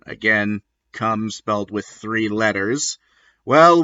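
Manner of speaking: plain reading voice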